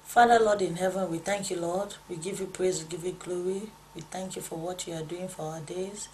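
soft, hushed prayer voice. Acted. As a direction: pray quietly and reverently to the Lord. Leads a prayer.

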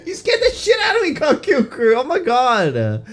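high, loud, laughing scream; shrieking through nervous laughter; laughing off fear. Real, not acted. Jump scare, screams and laughs.

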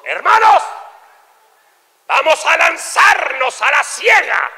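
high, loud pulpit shout; preaching pastor. Acted. Pastor rallying the congregation, shouted proclamation. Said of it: "dijo el pastor".

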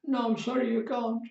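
high-pitched mock tearful voice. Bereaved person answers the caller asking for Mike, mock tearful. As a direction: put on a high, tearful phone voice. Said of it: "this tearful voice, I hope it's tearful anyway".

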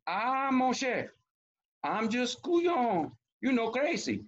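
high, slow placating crawfish; wheedling storybook crawfish voice; trying to compose himself. A narrator's reading. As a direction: stretch it out high to soothe an angry crab. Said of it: "trying to compose himself".

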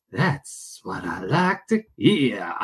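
slow, low, drawn-out put-on voice; put-on catchphrase voice. Streamer pleased to hear viewers, slow low mock drawl.

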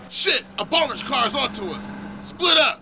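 loud alarmed call to scatter; urgent shout. Acted. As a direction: yell a warning mid-chase. Chase, urgent warning.

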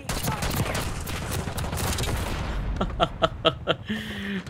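breathy laughing fit; high breathy giggle. Real, not acted. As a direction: giggle airily at own pun. Laughs at own joke.

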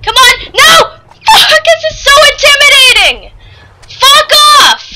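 loud, high frantic yelling; panicked shouting. Real, not acted. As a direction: yell like it's right behind you. Fleeing the shark.

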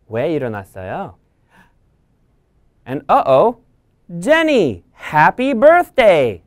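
ordinary delivery

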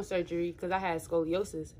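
talking through fingers; fingers on lips. Real, not acted. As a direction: hand partly over mouth. Seen 2: hand fingers rest on lips while talking, mouth partly covered.

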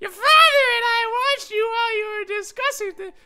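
very high, loud shrill character voice; high-pitched mom voice. Acted. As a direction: squeal it out as a frantic mom. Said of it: "what's his voice again".

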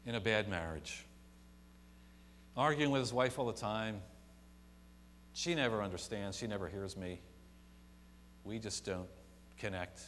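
normal talking voice

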